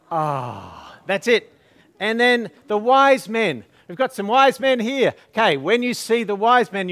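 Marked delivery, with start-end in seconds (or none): 0.1-0.9 low soft falling oh; a soft doting oh; aww for the baby. Shown as a demonstration. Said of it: "One, two, three".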